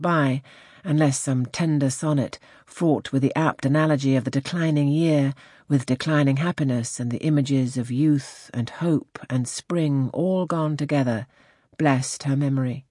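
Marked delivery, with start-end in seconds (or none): none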